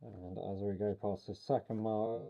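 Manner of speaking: talking through a face mask; muffled voice. Real, not acted. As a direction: speak muffled behind a mask. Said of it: "sorry for the muffled voice".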